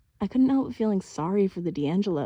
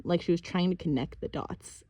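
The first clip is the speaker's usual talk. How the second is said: plain reading voice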